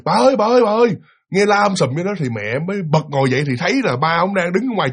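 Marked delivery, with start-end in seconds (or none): 0.0-0.9 high-pitched urgent call, imitating a man; retold calling out. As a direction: call out for his wife, pitched up. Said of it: "nghe là ba ông mới la lên, kêu". (giả giọng gọi vợ í ới, cao giọng)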